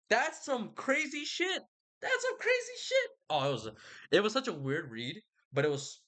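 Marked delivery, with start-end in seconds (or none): 0.1-1.6 excited. Real, not acted. Reacting to the river god. 2.0-3.1 "That's some crazy shit" squeaked much higher; squealing disbelief. Real, not acted. Reacting to the river god.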